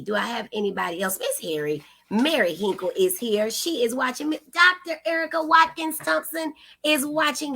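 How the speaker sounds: usual speaking voice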